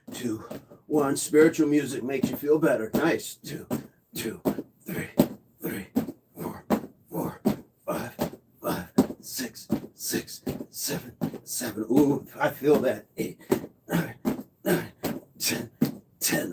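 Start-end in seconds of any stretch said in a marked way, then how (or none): 3.4-11.8 very soft doubled counts; hushed rep count. Real, not acted. Trainer working through a set, hushed count. Counting reps during exercise. 13.2-16.5 soft, short counts on the effort; hushed rep count. Real, not acted. Trainer finishing a set, hushed count.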